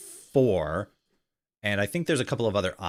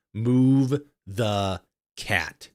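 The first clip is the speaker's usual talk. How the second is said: very slow, drawn-out order; slow menacing command; much slower than usual. Acted. Cat speaking through the narrator, slow ominous command. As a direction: stretch every word out like a threat.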